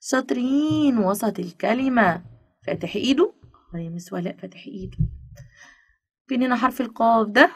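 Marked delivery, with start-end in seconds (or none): 3.8-5.0 much softer, small pupil reply; hushed stand-in for the children's answer; pupils answering teacher. Acted. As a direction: answer quietly as the class would. Voicing pupils answering their teacher.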